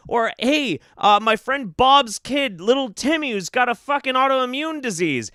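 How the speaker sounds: ordinary talk, nothing marked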